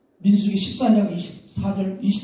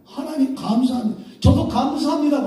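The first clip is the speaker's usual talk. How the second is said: eager thanks; 6 semitones higher than usual. Retold or imitated. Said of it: "그러잖아요".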